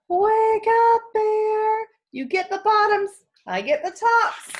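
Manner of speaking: high, loud wake-up call. Acted. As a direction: shout up to the sleeping bear. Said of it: "Hare called out".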